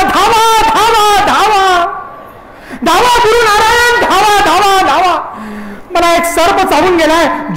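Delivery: high, drawn-out cries for rescue; crying out for help. A narrator's reading. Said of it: "संभा जोर जोरात ओरडू लागला".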